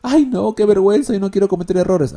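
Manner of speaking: hammed-up complaining pupil; whiny student. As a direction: play the student whining to the teacher. Student refusing to make mistakes.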